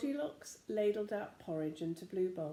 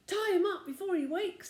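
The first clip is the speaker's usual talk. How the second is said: urgent order; 11 semitones higher, 7 dB louder than usual. A narrator's reading.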